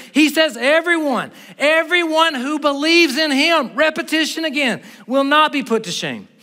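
high, loud preacher's drive; full-force preaching. Real, not acted. Pastor driving home the verse to the church, loud preaching. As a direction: pound the points home to the room.

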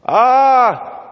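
high loud strained "ah" sound; imitating Terry's strained attempt at a vowel; imitated strained "ah". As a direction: mimic a brain-injured girl's effortful vowel. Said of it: "Terry responds".

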